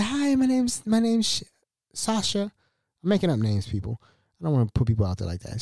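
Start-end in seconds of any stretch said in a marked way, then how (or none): 0.0-2.5 high, lightly put-on girl's voice; high-pitched girl. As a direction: imitate a girl introducing herself in a higher voice. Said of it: "my name's Sasha".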